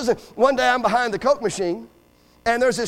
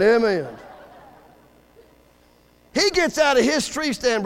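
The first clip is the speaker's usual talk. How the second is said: ordinary delivery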